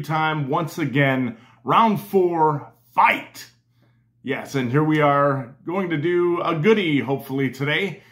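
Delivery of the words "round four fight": booming fighting game announcer. Pretend.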